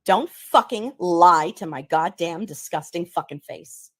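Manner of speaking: slow, loud, furious, every word spat out; slow furious rant. Acted. Ranting at a liar to their face, slow and loud. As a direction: lay into a liar slowly and loudly.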